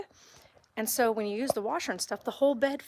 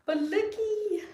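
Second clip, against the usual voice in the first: high, slow sing-song coo; 11 semitones higher, slower than usual. Real, not acted. Cooing at the cats, high and slow. Showing cats the new tower.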